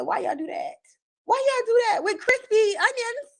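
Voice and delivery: high-pitched incredulous complaint, retold; mock dismay. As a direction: plead in exaggerated disbelief, voice climbing high. Mock outrage at odd food.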